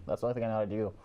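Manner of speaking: ordinary delivery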